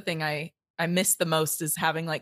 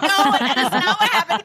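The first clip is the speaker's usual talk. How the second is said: laughing while correcting the story; high, loud laughing denial; laughing protest; 10 semitones higher, 7 dB louder than usual. Real, not acted. Guest laughing as she corrects the story, high and loud.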